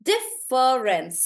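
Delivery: "Difference" said slowly as dif-fer-ence; three slow beats in "Difference"; counting syllables slowly. Shown as a demonstration. Teacher sounding out the word to count three syllables. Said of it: "Could be three".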